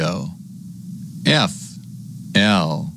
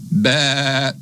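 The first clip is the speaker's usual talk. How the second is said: higher, held sheep baa; sheep bleat; 6 semitones higher than usual. Pretend. Sheep sound after spelling sheep.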